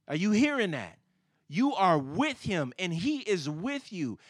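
high, loud, ringing sermon delivery; full-force preaching. Real, not acted. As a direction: drive each phrase home to the congregation.

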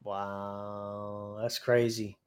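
ordinary talk

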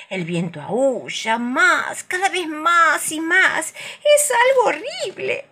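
high, slow eerie wail; howling wind voice. A narrator's reading.

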